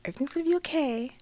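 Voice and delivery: soft, gentle reassurance; calm mother. Retold or imitated. Imitating mom reassuring her pregnant daughter, soft and calm. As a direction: speak softly and evenly, no alarm. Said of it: "talking to me so calm".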